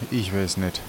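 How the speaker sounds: normal talking voice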